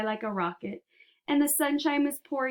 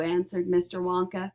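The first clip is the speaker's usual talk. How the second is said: ordinary delivery, nothing marked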